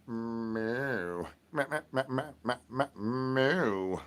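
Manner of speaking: quiet stuttered meowing; soft cat meows. Acted. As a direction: meow softly like a cat. Cat noises in routine.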